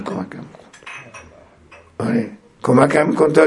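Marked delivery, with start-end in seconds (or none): 0.0-0.7 a hushed, breathy-free whisper of begging; soft whispered supplication to God; whispered plea. Retold or imitated. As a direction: drop to a whisper as if praying alone. Quoting the Imam's plea to God.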